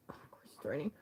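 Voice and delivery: whispered wry aside. Real, not acted. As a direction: breathe it under the voice.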